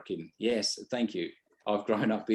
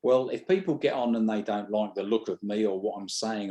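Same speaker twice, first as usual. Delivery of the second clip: normal talking voice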